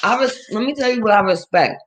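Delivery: ordinary talk, nothing marked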